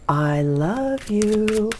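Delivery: very slow, soft, drawn-out delivery; tender declaration. Acted. Tarot reader voicing the card's love message, slow and tender. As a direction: linger lovingly on every word. Repeats the loving card message.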